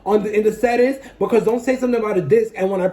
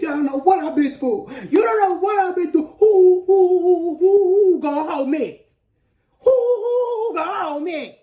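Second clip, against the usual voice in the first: mock wailing; 10 semitones higher than usual. Dramatic mock wail.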